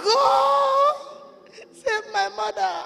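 high, tearful shout to God; crying out. Retold or imitated. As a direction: cry out a desperate prayer. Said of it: "I said".

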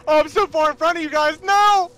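high panicked screams; panicked screaming. Real, not acted. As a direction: scream in real terror. Zombie chasing them, panic.

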